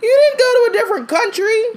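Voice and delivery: very high, fast disbelief; incredulous retort. Real, not acted. Host scoffs at her cousin, very high and fast.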